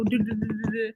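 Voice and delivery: do-do-do sung as a drum roll; vocal drum roll. Pretend. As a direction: drum it in with your voice. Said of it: "drum roll".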